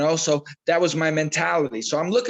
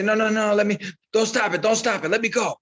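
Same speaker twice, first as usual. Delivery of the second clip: mildly begging, pitched higher; 4 semitones higher than usual. Acted. Cut fighter to coach who wants to stop sparring, begging. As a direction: plead not to be pulled out, pitch raised. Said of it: "I want him to beg me".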